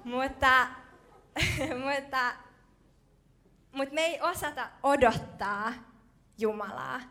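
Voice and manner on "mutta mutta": laughing through a sheepish "mutta". Real, not acted. Preacher laughing at his own burger confession. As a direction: caught out, laughing it off.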